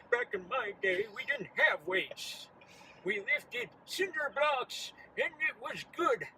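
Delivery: put-on high, slow old man voice. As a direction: stretch it out like a cranky old-timer. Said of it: "invoke the, uh, old man voice".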